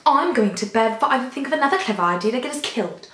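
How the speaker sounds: lively put-on English accent; British English accent. Shown as a demonstration. Showing viewers an accent learned from Harry Potter, British English accent. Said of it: "my British English accent".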